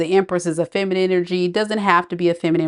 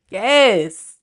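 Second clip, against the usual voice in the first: normal talking voice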